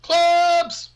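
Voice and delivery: high, loud joking yell of the sign's word; mock shout. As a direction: bellow it like a proud announcement. Echoing the sign excitedly.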